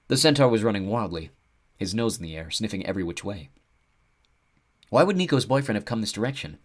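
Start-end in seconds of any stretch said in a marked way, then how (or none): none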